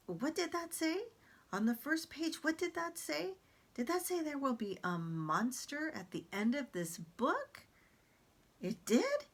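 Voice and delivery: high, dramatic furry monster voice; Grover voice. Acted. Reading Grover to the class, high and dramatic. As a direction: play a jittery puppet monster who fears the book. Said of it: "Grover is very exaggerated".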